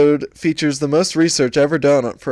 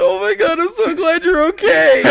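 much higher, mock tearful mom voice; imitating crying mom; 3 dB louder than usual. Mom finding her son alive, mock tearful and high. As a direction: play your relieved, weepy mom, hammed up. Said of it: "my mom comes up to me crying".

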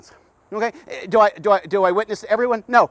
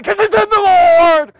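higher, louder and slow, leaning on every word; booming slow declaration; 8 semitones higher, 9 dB louder, slower than usual. Acted. Preacher telling the men's group, booming slow. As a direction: proclaim it to the whole hall.

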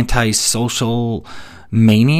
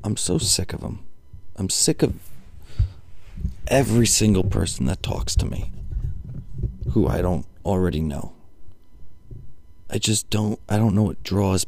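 much softer, low-energy grumble; weary exasperation; 4 semitones lower, 9 dB quieter than usual. Real, not acted. Comic sick of strangers who talk to him, quietly weary. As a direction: drop the volume right down, fed up. Fed up after his rant.

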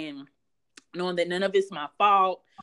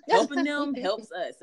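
husband voice; 7 semitones higher, 4 dB louder, slower than usual. Retold or imitated. Husband quoted, slow, higher.

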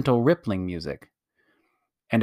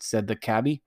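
usual speaking voice

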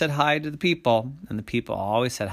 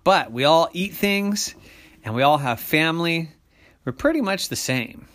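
higher, emphatic persuading voice; reasoning person; 6 semitones higher, 5 dB louder than usual. A narrator's reading. Person listing what everyone shares to monsters, reasoning. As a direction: make each point firmly at raised pitch.

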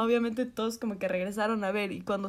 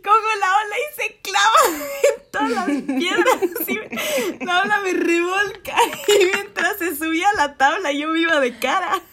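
laughing through words; 8 semitones higher, 6 dB louder than usual. Real, not acted. Laughing through the wipeout story.

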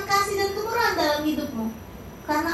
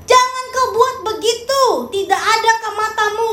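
put-on higher, louder scolding of a child; a parent snapping at a naughty kid while cooking; angry parent scolding; 4 semitones higher, 4 dB louder than usual. Parent scolding a child while cooking, angry and loud. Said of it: "Tapi tetap marah sambil".

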